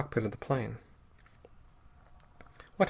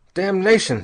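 loud, raised savage curse; furious outburst, pitched up; 7 semitones higher, 8 dB louder than usual. A narrator's reading. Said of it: "said Bell savagely".

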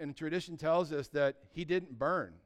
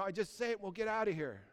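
ordinary talk, nothing marked

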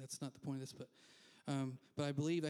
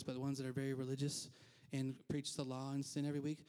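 ordinary talk

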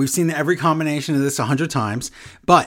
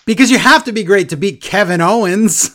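louder, higher wrestler's boast; mock boast; 4 semitones higher, 6 dB louder than usual. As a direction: imitate a wrestler bragging to the crowd.

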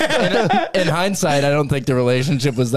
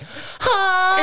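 high confused huh; baffled huh. Retold or imitated. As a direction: squeak out a stunned huh. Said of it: "we were like".

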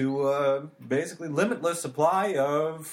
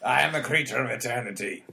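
gruff ancient being; faster than usual. Acted. Nemo voice continues.

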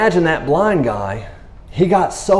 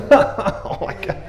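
breathy laughing awe; 6 semitones higher, 4 dB quieter, much slower than usual. Real, not acted. Amazed laugh at healing.

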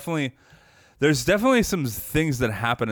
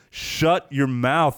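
slow, raised-pitch exasperated retort; pissed off; 4 semitones higher, much slower than usual. Retold or imitated. Host retelling anger at the movie's monologue, exasperated. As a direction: drag out each word, fed up. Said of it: "getting so fucking pissed".

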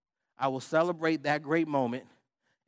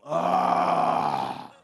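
long loud "ah" of frustration; exasperated yell; 8 dB louder than usual. Retold or imitated. Husband forced to pray while mad, exasperated yell. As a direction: let out a drawn-out, loud groaning yell.

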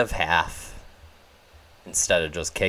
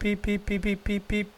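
news alert beeps; 13 semitones higher, 4 dB quieter than usual. Pretend. Imitating breaking news alert.

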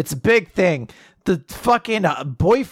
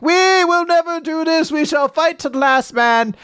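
defiant general; 10 semitones higher than usual. Retold or imitated. Evil general refusing to leave the battle, hammed-up defiance. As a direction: play a pompous general refusing to retreat. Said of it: "evil general of the army is like".

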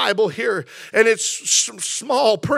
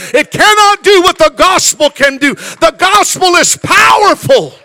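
full-force preaching, building louder and higher to a shout; 5 semitones higher, 7 dB louder than usual. Real, not acted. Preacher tells the congregation money cannot match the gospel, building to a shout. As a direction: drive each line harder like a fired-up preacher.

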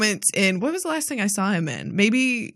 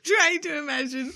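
laughing through the words; higher, softer words breaking out of a laugh; amused; 6 semitones higher, 4 dB quieter than usual. Real, not acted. Co-host answering the host's challenge about casting, amused.

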